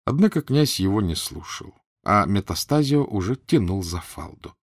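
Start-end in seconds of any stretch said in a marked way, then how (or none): none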